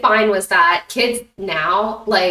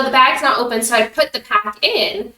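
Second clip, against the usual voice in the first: ordinary delivery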